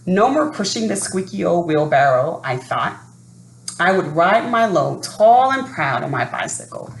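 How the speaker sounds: usual speaking voice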